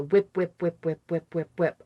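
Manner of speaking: rhythmic, repeated reel flapping; imitated film reel. Recalling the movie that triggered the episode, rhythmic imitated whipping. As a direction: whip the word round like a spinning reel. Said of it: "the sounds that, that occur to me".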